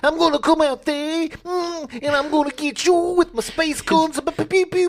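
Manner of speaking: high, loud hammed Brooklyn hero; Brooklyn accent. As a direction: shout it like a Brooklyn tough guy. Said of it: "he didn't sound like this".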